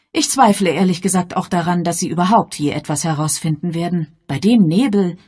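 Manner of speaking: deutlich höher, selbstbewusste Dame; elegante Dame. Acted. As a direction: weltgewandt und bestimmt, hoch. Lady Sedgwick voiced. (Bess Sedgwick spricht zum Inspektor, selbstbewusst)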